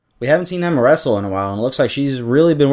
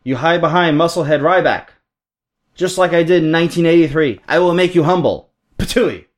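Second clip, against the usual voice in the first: higher, emphatic, badly faked Iron Sheik accent; terrible Iron Sheik accent; 5 semitones higher than usual. Iron Sheik threatens Ryback on the mic, badly faked Sheik accent. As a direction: taunt in a bad Sheik accent, ending on a mock spit. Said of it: "That's a terrible Sheik accent".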